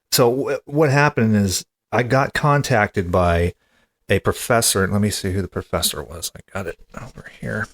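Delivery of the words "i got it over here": normal talking voice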